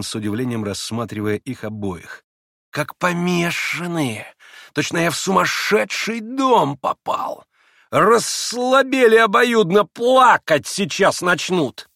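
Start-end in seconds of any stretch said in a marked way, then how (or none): 2.7-11.9 higher, louder sneering man; mocking Rakitin. A narrator's reading. Rakitin taunts Grushenka, sneering and loud. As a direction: tease her with contempt.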